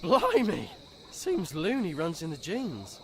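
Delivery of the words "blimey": surprised exclamation. Acted. Seeing Luna's father's outfit.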